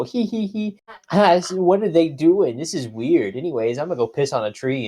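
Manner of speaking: high giggly cartoon hamster chatter. Mocking the anime's school episodes, high cartoon hamster voice. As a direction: squeak it out like a silly cartoon hamster. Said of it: "they go".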